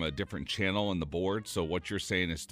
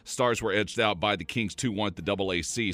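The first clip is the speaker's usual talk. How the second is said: sports recap; 4 semitones higher, 5 dB louder than usual. Acted. Reads hockey score recap.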